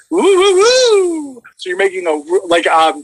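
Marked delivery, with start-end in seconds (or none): 0.1-1.4 high, slow sliding woo through the straw; low-to-high trill. Shown as a demonstration. Vocal coach demos the low-to-high trill for the guest. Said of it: "So it'll sound like this".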